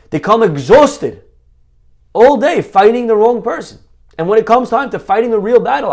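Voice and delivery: loud, high preaching; sermon delivered full force. Acted. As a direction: rouse the room from the pulpit. Rabbi rousing a crowd.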